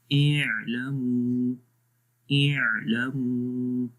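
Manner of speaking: اعلموا with the ع held a moderate time, slowly; medium-length ain. Shown as a demonstration. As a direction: release the ع in اعلموا gently, neither cut nor stretched. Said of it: "درمیانی آواز میں پڑھیں گے". (اعلموا درمیانی آواز میں، عین ہلکا سا جاری)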